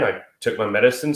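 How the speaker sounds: normal talking voice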